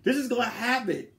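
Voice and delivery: put-on ex-girlfriend scolding him for crying; harsh scolding. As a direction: bark at him to quit crying. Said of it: "That's what she said".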